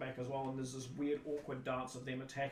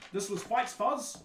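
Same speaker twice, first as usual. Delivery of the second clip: loud, higher, slow introduction; announcing stage player; 7 semitones higher, 8 dB louder, slower than usual. Acted. Performer introduces cast to the audience, slow announcement. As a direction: present the cast member grandly. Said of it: "one of the people stands up and goes".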